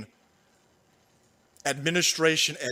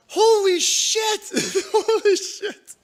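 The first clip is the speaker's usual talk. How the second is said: high, slow, drawn-out disbelief; stunned holy shit; 13 semitones higher, 5 dB louder, slower than usual. Real, not acted. Reacting to the news in high, slow laughing disbelief. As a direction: stretch it out, laughing in shock.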